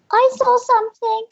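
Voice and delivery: high little elf piping up; a small elf keen to tell; eager elf voice. Acted. Third elf raises hand.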